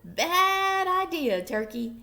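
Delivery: high, loud, slow scold; loud slow rooster warning. A narrator's reading. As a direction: drag it out as a warning.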